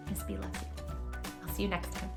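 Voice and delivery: ordinary delivery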